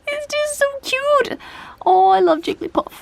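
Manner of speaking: high-pitched gushing coo; adoring squeal. Real, not acted. Collector gushes over a Jigglypuff card, adoring squeal.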